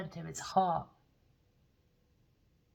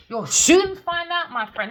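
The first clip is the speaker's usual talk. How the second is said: slow warning; 10 semitones higher, 9 dB louder than usual. Real, not acted. Warning the energy.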